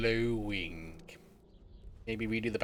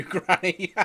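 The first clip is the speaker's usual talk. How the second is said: louder, laughing through the word; 7 semitones higher, 7 dB louder than usual. Real, not acted. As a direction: laugh as you say Granny. Laughs while saying it.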